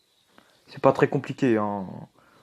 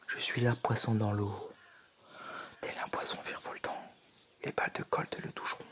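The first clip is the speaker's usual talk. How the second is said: whispering; 3 semitones lower, 13 dB quieter than usual. Pretend. Dramatic in-game whisper.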